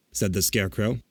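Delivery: ordinary delivery, nothing marked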